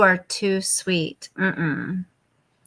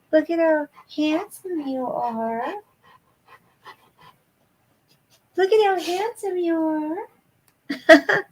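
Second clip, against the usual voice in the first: cooing praise; 6 semitones higher than usual. Real, not acted. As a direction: gush over the handsome dog. Praising dog on camera.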